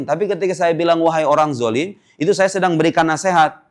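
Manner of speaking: ordinary talk, nothing marked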